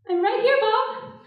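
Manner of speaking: loud, high-pitched flustered reply; flustered protest. Acted. Woman to lover who must see her, flustered protest. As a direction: protest loudly, voice jumping up. Alarmed at lover wanting to look.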